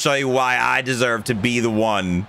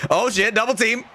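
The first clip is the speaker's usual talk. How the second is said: higher, flustered rush; panicking gamer; 6 semitones higher than usual. Real, not acted. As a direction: scramble and correct yourself. Panicking in game.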